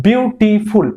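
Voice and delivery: বিউটিফুল broken up to count three syllables. Shown as a demonstration. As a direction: say বিউটিফুল as beau-ti-ful, each part distinct. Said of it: "তিনটে syllable উচ্চারণ করছো".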